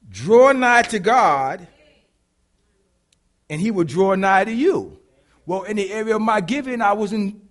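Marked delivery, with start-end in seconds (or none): 0.1-5.0 very slow, loud drawn-out reading; slow scripture emphasis. Acted. Preacher reading the verse to the church, very slow and loud. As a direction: savor each word of the verse.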